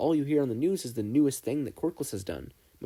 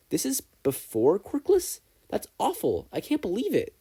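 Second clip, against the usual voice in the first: horrified disbelief; 9 semitones higher than usual. Acted. Horrified student reacts to stats.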